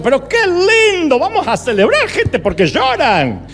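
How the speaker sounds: high, jubilant mock celebration; Juan Carlos Ortiz. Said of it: "Juan Carlos siempre hacía eso".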